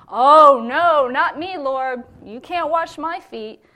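high, loud cry of refusal, slow at the end; Peter's loud protest. Retold or imitated. Peter telling Jesus he can't wash his feet, loud high protest. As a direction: protest loudly, like someone who can't accept the gift.

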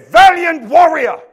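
loud, high, slow shout; shouted proclamation. Acted. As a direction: shout it as the angel's decree. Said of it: "and said".

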